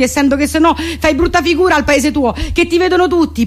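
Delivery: ordinary talk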